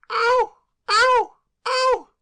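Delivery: very high, very loud wailing ow; piercing scream. Acted. Claire screaming in the kitchen, piercing. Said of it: "A piercing scream rang".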